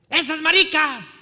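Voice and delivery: high, very loud, drawn-out heckle; full-volume mocking yell; imitated jeering shout. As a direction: bellow the taunt slowly. The jeer repeated, now shouted.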